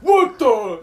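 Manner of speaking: loud, high shocked exclamation; an old man jolted by a scream; startled what the. Retold or imitated. Old man startled in the gas station bathroom, a loud high what the. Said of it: "the old guy's like".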